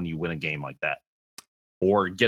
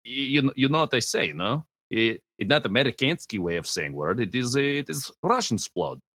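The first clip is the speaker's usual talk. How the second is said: hammed-up Russian accent voice; thick joke Russian accent, broken English; 3 semitones higher than usual. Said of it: "this stupid Russian voice".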